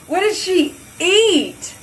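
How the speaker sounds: high, slow, grossed-out question; appalled at the stink; disgusted by smell. Real, not acted. Dog poop smell in bathroom.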